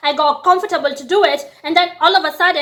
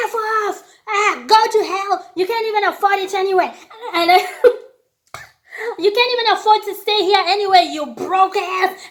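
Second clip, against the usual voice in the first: higher, laughing mock yell; laughing taunt; 5 semitones higher than usual. Yelling at the man as he leaves, laughing taunt. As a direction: jeer at him through laughter.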